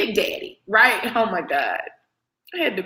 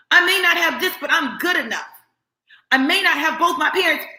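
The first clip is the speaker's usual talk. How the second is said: defiant self-talk; 7 semitones higher than usual. Retold or imitated. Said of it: "you're busy trying to convince the world".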